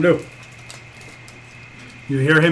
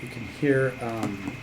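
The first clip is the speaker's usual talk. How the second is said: softer, slow, hushed game master; suspenseful narration; 6 semitones lower, 13 dB quieter, slower than usual. Acted. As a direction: draw them in, slowly and quietly. GM builds suspense at door.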